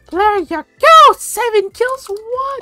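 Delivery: very high, excited squeal; excited yelling. Real, not acted. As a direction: cry out in high-pitched amazement. Celebrating a kill streak.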